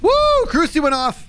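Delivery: high, excited yelp; startled whoop. Real, not acted. Player startled as the crucifix goes off, startled whoop. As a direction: whoop in sudden surprise.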